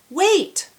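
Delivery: high, loud shout. Acted. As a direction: yell to stop her. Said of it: "the hungry farmer shouted".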